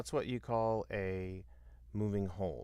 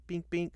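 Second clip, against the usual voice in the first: high, bright-pitched double ping, punched louder; bing going up; 9 semitones higher, 5 dB louder than usual. Retold or imitated. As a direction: hit two high notes for the upward accent.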